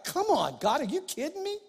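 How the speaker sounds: lightly played frustrated prayer; mock exasperation. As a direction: throw up your hands at God.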